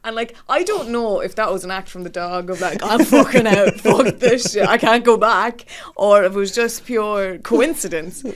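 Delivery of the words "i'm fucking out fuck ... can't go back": loud mock outburst through laughter; fed-up dog. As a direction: shout it like a dog that has had enough. Voices the fed-up dog bailing out.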